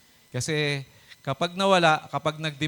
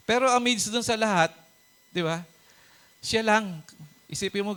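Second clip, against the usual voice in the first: ordinary talk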